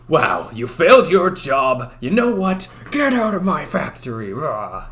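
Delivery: voice-acted angry boss. Shown as a demonstration. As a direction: act a boss firing someone, ending in a growled rah. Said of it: "Voice like this".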